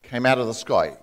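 normal talking voice

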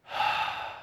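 long, soft resigned sigh; drawn-out bargaining sigh; imitated sigh. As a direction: sigh like a seller about to give in. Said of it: "looks at me in that sigh".

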